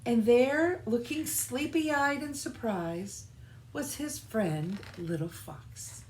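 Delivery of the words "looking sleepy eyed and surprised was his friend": usual speaking voice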